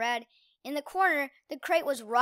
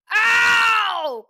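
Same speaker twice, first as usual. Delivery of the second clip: loud high yelp of pain; pained shout; 8 semitones higher, 12 dB louder than usual. Acted. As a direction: yell out in pain. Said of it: "Quinn cried out".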